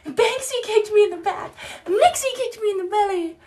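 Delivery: mock the kids telling on each other; whiny kid complaint.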